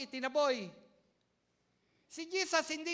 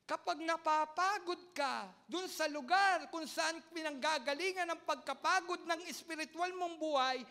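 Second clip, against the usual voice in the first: ordinary delivery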